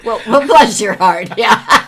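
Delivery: laughing loudly through the words; cracking up while echoing. Real, not acted. Laughing echo of Southern phrase.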